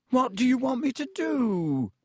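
a beaten man's whimper; high, weak and pleading; weakly. A narrator's reading. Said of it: "said Lockhart weakly".

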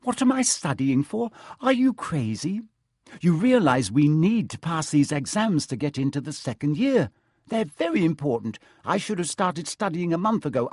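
put-on high, anxious, indignant girl; fretful bossy student voice; exasperated schoolgirl. Hermione exasperated about exams.

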